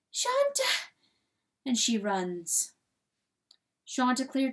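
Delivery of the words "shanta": high, distant desperate call. A narrator's reading. Hen still calling for Chanticleer, high plaintive cry. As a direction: cry the name again, high and lost. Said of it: "crying his name through the night".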